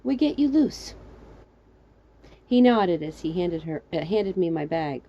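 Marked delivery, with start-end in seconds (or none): 0.0-0.9 put-on "We get you loose" in a light foreign accent, pitched up on "you"; the stranger's halting foreign-accented "We get you loose". A narrator's reading. Rescuer reassures girl stuck in well, light foreign accent.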